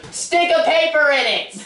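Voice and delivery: drawn-out, high call down a hallway; mock yell. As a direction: imitate the principal calling out. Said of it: "yell from the hallway".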